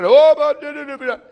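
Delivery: high, loud mock uproar; hammed-up troll outcry; outraged trolls. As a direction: mimic trolls jumping up in a high, loud gabble. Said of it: "hoplasın böyle bazı troller var".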